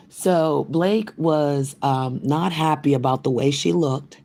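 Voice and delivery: ordinary talk, nothing marked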